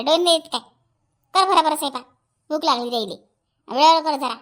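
loud, impatient orders; loud, bossy nagging; angry scolding. Acted. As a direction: scold and hurry her along. Acted scolding, ordering food cooked.

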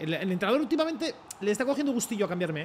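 ordinary talk

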